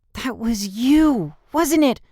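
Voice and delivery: high, slow, stunned realization; surprised. A narrator's reading. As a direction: gasp it out in disbelief. Girl recognizes him, surprised.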